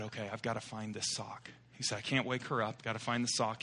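soft, hushed self-talk; trying not to wake her. Retold or imitated. Husband searching bed quietly, not waking wife, hushed. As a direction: keep it down so the wife sleeps on.

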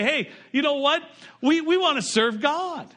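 high, bright-eyed promise to serve; eager mock pledge. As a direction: play the keen churchgoer signing up. Congregation quoted, eager pledge.

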